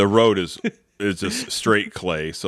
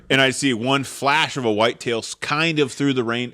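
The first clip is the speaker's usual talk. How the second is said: ordinary talk, nothing marked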